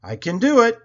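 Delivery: loud, high, effortful cry; Stanley, straining. A narrator's reading. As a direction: push it out while heaving hard. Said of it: "Stanley wheezed".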